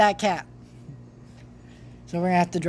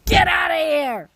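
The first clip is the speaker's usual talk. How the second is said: sudden high shout at the cat; retold yell; 12 semitones higher, 6 dB louder than usual. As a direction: yell it out sharp and loud to chase the cat off. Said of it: "I yell".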